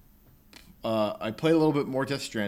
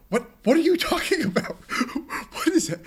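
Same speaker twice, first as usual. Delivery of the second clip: mock baffled cry, much higher; baffled player; 4 dB louder than usual. Gamer mocking a baffling cutscene, high mock disbelief. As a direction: throw up your hands at nonsense, pitched way up. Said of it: "It's just like".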